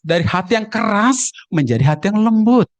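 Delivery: "keras" and "lembut" with every final consonant sounded; careful enunciation. Shown as a demonstration. Preacher showing the proper form against dialect, "keras" and "lembut" fully enunciated. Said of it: "kalau dieja bagus-bagus".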